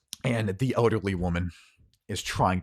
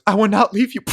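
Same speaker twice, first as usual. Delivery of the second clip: high, loud refusal; defiant cry; 13 semitones higher, 12 dB louder than usual. Acted. Woman refuses to abandon Harlan, loud and defiant. Acted refusal before being shot.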